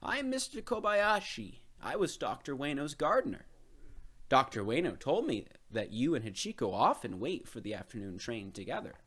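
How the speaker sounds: put-on a kindly old gardener introducing himself. A narrator's reading.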